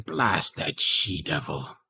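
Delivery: slow, low cursing to himself; a lightly acted professor cursing his pupil; Professor Higgins. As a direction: curse slowly under a dropped pitch. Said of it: "he would be in the other room going".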